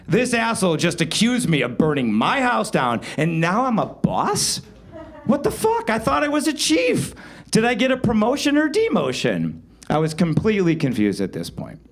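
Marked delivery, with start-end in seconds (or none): 5.2-7.1 much higher, indignant outburst; sudden high indignant what-the-fuck; outraged disbelief. Real, not acted. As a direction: explode in baffled outrage. Pissed at being called boss.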